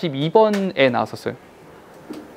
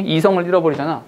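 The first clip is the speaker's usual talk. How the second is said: ordinary talk, nothing marked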